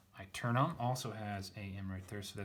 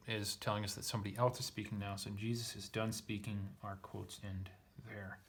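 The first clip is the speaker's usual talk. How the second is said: ordinary talk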